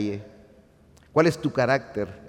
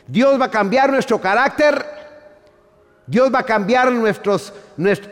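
much higher and louder, full-force sermon delivery; fiery preaching; 9 semitones higher, 8 dB louder, slower than usual. Acted. Pastor proclaiming to the congregation that God will change our character, fiery.

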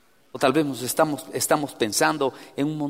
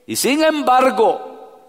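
loud, high preacher cry; preaching at full force; 10 semitones higher, 9 dB louder, slower than usual. Acted. Preacher urging the congregation to cry out to God, loud and high. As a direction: lift it into a pleading call.

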